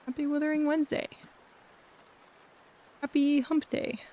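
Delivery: high bright welcoming streamer voice; cute anime streamer. Acted. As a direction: greet the viewers sweetly, up high. Greeting raid viewers in streamer voice.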